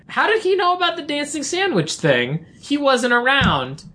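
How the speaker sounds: high, bewildered mock voice; confused high voice. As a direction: squeak it out, puzzled. Retelling her own confused reaction.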